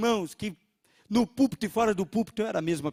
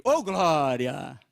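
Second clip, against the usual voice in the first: joyful exclaim; 4 semitones lower, 3 dB louder, much slower than usual. Retold or imitated.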